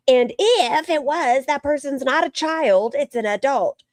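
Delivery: high, drawn-out mocking quote; mocking imitation. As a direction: mimic her talking down to the audience, slowly and high. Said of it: "mocked her audience and was like".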